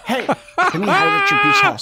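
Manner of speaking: breaking into laughter mid-line; cracking up while speaking; laughing through words. Real, not acted.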